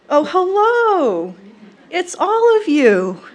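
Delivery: high, loud, drawn-out excited welcome; grandmother's signature greeting. Acted. Granddaughter greeting mourners as Gram would, excited. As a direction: greet them with a big swooping, delighted holler. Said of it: "I welcome you on her behalf with her greeting".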